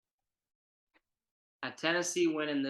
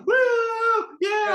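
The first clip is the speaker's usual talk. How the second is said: cheer; 9 dB louder than usual. Pretend. Cheering at the game.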